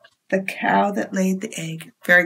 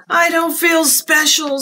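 a touch of gloomy cow, pitched up; glum cow voice; 8 semitones higher, 3 dB louder than usual. Acted. Marjorie the cow telling chickens she feels plain, glum. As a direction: sigh it out like a sad cow.

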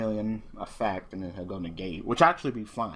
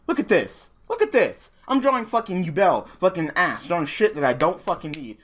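loud, high, furious rant at the draws; angry rant; 9 semitones higher, 8 dB louder than usual. Real, not acted. Gamer yelling at his own bad draws, furious and loud. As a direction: yell it out in frustration.

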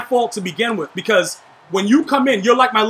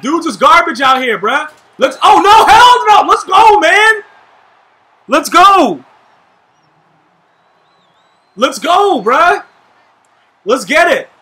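hype yelling; 6 semitones higher, 7 dB louder, slower than usual. Real, not acted. Trash talk and hype mid-play.

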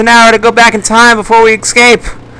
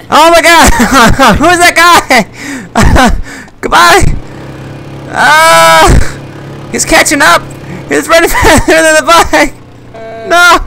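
high-pitched panicked laughing yelps; freaking out while being chased; 9 semitones higher, slower than usual. Real, not acted. Chased by a zombie on a bike.

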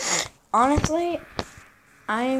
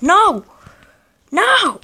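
high, loud burst of dismay; dismayed yell; 7 semitones higher, 5 dB louder than usual. Real, not acted. Player getting beaten in the game, dismayed yell. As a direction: cry out in protest.

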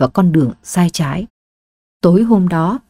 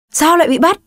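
sharp snap; 9 semitones higher, faster than usual. Acted. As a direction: snap it out at his wife. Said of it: "Ông Quang quát lên".

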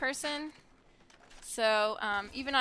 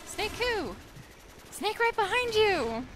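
put-on much higher and slow, a small boy; a high, slow little-boy punchline; three-year-old's voice; 11 semitones higher, slower than usual. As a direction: squeak it up like a toddler. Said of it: "He was saying".

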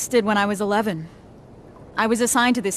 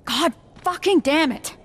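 higher-pitched burst of frustration; frustrated, punched-out curse; exasperated cursing; 6 semitones higher than usual. Acted. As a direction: swear in sheer exasperation.

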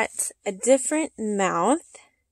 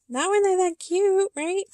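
high, loud gushing; excited gushing; 10 semitones higher, 6 dB louder than usual. Real, not acted. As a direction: squeal about cuteness. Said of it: "I cannot help talking like that".

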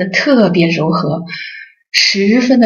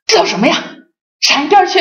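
a sharp retort that jumps much higher on "闪一边去"; mock snapping; 10 semitones higher, faster than usual. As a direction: brush the husband off, sharp and dismissive. Wife snaps at husband. (模仿妻子不耐烦地呵斥丈夫)

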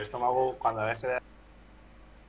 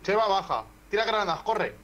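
urgent high shout to teammate; grenade warning yell; 8 semitones higher, 4 dB louder than usual. Real, not acted. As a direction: yell it fast, under fire. Urgent call during firefight.

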